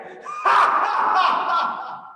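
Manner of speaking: big hearty laugh, high and loud; laughter yoga laugh. Shown as a demonstration. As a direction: belly laugh to lead the class. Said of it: "जोर से हंसिए".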